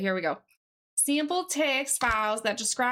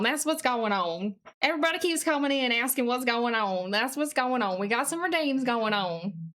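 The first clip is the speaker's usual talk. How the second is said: put-on silly Southern accent. Streamer telling newcomers about redeems, hammed-up Southern accent. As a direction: ham up a folksy Southern accent. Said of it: "I'm doing this silly accent".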